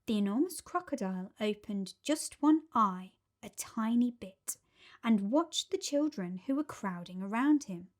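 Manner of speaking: plain reading voice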